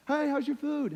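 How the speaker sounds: high-pitched friendly asker; imitated upbeat small talk; chirpy mock question. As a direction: pipe up as a cheery dining companion. Acts out an imagined question.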